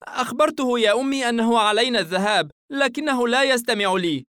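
put-on girl complaining about her brother, pitched high; young girl. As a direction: play a daughter explaining herself to mother.

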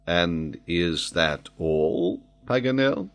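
slow, quiet and cool; a calm, unbothered query; coolest tone. Acted. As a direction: ask it as if it hardly matters, unhurried and soft. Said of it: "in the coolest tone possible".

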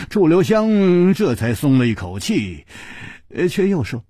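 ordinary delivery, nothing marked

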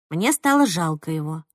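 usual speaking voice

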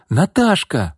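high-pitched exclamation of happy surprise; exclaiming. A narrator's reading. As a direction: call out to an unexpected friend. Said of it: "воскликнула Марина". (удивлённый возглас)

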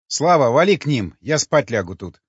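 plain reading voice

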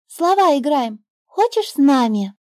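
put-on the girl inviting the bird to play; little girl. A narrator's reading. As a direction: invite like an eager child. Girl's voice.

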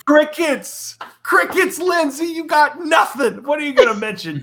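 high-pitched teasing shout; mock heckling. Teasing a co-host who has no show to name, mock heckling.

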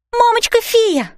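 high, drawn-out call of alarm; panicked cry. A narrator's reading. Girl warning fairy mother, panicked cry. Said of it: "в панике закричала я".

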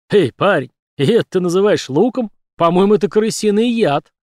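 loud, jeering tradesman; mocking fat man. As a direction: jeer at him from the cart. Fat man mocks Doyle.

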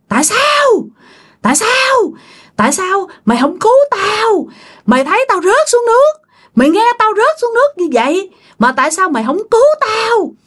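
high, eerie accusing wail; wailing ghost. Acted. Old ghost woman lunging at the dreamer, high wailing accusation. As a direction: howl the blame like a drowned hag. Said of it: "Tự nhiên gào lên nói vậy đó".

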